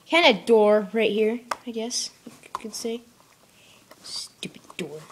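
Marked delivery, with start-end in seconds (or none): 4.0-5.0 annoyed whisper; whispered grumble. Real, not acted. Fiddling with the case door, whispered grumble.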